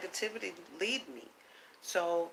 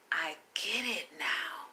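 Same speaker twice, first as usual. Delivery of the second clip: soft whisper, slower; whispered realization; 5 dB quieter, slower than usual. Real, not acted. Realizing the lesson, a slow soft whisper. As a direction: let it slip out under the breath.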